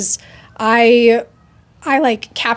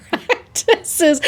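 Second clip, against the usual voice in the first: laughing; 7 semitones higher than usual. Real, not acted. Laughing at own quoted email.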